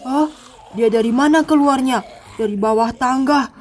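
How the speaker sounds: sneak and murmur to yourself; hushed scared heroine. Acted. Sakura sneaks past zombies alone.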